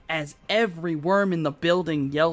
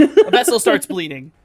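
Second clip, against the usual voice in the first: laughing; 6 semitones higher, 11 dB louder than usual. Real, not acted. Laughing while repeating a phrase.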